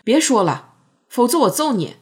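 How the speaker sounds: loud, high furious threat; angry shout. Acted. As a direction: yell to make him stop. Narrator threatens Nan angrily.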